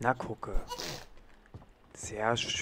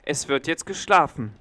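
stern order; 4 semitones higher, 7 dB louder than usual. Acted. Sitter orders baby to sleep.

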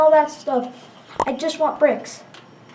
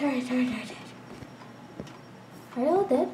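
ordinary talk, nothing marked

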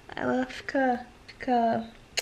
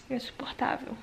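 whispered, almost no voice; 13 dB quieter than usual. Real, not acted. Mother confiding the nursing pain, whispered. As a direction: breathe it out under your breath.

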